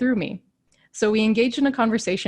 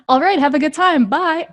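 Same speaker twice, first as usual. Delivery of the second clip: higher, louder breezy farewell; cheery goodbye; 6 semitones higher, 6 dB louder than usual. Retold or imitated. Gentleman breezily leaving Camille after his advice, higher and louder. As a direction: toss it off brightly and walk away.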